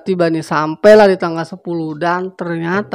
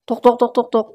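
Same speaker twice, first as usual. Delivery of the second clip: higher, loud tok-tok knocking sound; spoken knocking; 7 semitones higher, 4 dB louder than usual. Acted. Rusman arriving at Doni's house, knocking: spoken knock.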